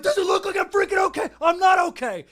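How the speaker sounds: high, loud furious yell; angry yell. Retold or imitated. Retelling yelling at girls after the car crash, angry shout. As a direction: scream it at them, livid over the wrecked car. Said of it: "I just yelled at 'em".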